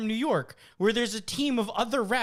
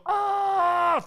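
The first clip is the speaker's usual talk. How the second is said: high fake injury wail; mock pained cry; 14 semitones higher, 6 dB louder than usual. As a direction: play the hurt soccer diver. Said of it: "fall on the floor and go".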